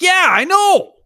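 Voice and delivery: high, loud sarcastic yeah I know; mock agreement. Telling his daughter the store cheese tastes worse, loud and high mock agreement.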